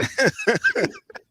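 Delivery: squealing laugh; high squealing laugh. Real, not acted. Laughing at his own impression, squealing.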